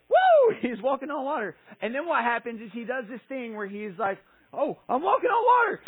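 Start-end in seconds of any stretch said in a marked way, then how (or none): 0.1-0.6 high, loud "woo" of a leap; whooping. Acted. Peter leaping onto the water. 4.5-5.8 very high, loud squeal of discovery; hammed-up thrilled Peter; amazed elation. Peter amazed he walks on water.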